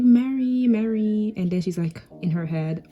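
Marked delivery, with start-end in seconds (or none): 0.0-1.3 higher, louder drawn-out call; calling her name. Acted. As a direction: call out to get her attention. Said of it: "like, calling her".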